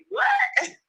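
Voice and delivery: very high, incredulous squeak; retold surprise. Reacting to being told she sounds from Detroit, mock-incredulous. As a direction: replay your disbelief way up high.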